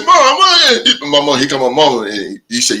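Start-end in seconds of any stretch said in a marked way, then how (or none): 0.0-1.0 calling for mom. Retold or imitated. Calling mama mid-beating.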